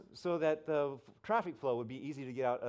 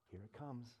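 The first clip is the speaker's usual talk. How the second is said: inner voice; 2 semitones lower, 13 dB quieter than usual. Retold or imitated. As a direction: drop to a quiet aside, warning what is coming. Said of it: "I hear".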